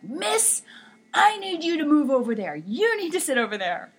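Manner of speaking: high, loud bossy order; mock screaming principal. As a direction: bark orders like a cross principal. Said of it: "he started screaming at me".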